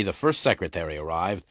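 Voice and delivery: ordinary delivery, nothing marked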